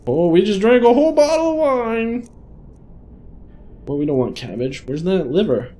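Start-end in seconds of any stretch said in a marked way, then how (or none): 0.1-2.3 mock drunken exclamation. Player announcing his character's whole bottle of wine, mock drunken exclamation. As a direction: exclaim it high and loud, playfully tipsy.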